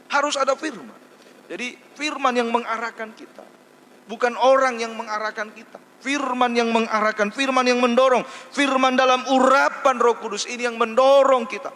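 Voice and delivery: loud, high, insistent pulpit preaching; preaching at full force. Acted. Pastor preaching to the congregation, loud and high. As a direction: hammer the message home to the congregation. Preacher drives home the point.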